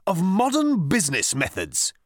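haughty. A narrator's reading. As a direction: pronounce it with hauteur. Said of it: "good old-fashioned hauteur".

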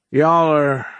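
slow, high, folksy drawl, let down; like a father tired of his son's failings; disappointed. A narrator's reading.

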